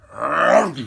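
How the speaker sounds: a sharp loud woof; loud dog bark. Acted. Dog barking at the leaf hunt, loud woof.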